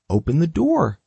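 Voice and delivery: normal talking voice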